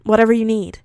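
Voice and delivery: ordinary delivery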